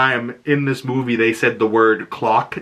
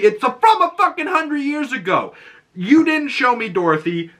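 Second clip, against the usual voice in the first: high-pitched comic outrage; exasperated rant; 9 semitones higher than usual. Acted. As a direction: tell off the filmmakers in a raised pitch. Ranting at filmmakers over Dorothy.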